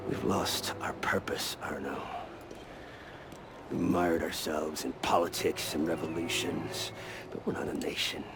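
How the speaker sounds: hushed, low and deadly serious; whispering low to Arno about treason; low intense whisper. Acted. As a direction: lean in and whisper the hard truth. Belek confides in low voice.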